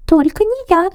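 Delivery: high squeaky refusal; Круть squeaking his refusal; squeaking mouse. A narrator's reading. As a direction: squeak out not me. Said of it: "запищал Круть".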